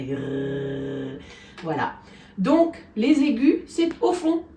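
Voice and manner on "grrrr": low, slow buzzing grrrr; vocal fold buzz. Shown as a demonstration. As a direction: let the throat rumble slowly. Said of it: "ça fait". (imitation lente et grave de la vibration des cordes vocales)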